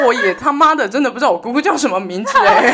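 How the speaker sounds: high, loud laughing confession. Real, not acted. Patient admitting to friends he forgot his aunt's name, laughing. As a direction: admit it while cracking up.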